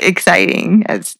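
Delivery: usual speaking voice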